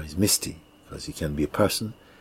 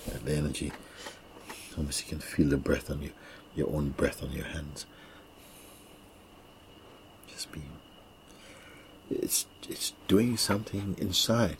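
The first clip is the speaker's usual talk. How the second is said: airy breathy mystical healer; 3 dB quieter than usual. Acted. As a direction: guide them inward, hushed. Guides a quiet meditation.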